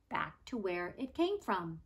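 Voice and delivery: plain reading voice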